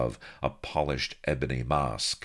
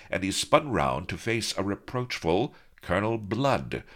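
ordinary delivery

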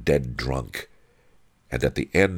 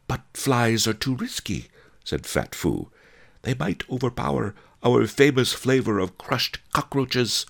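cautioning wife; 10 semitones higher than usual. A narrator's reading. Fat Fu warning her husband about flies, high-voiced caution. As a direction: object in a high character voice.

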